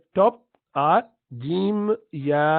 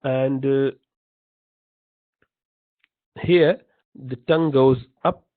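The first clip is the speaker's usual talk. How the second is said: ordinary talk, nothing marked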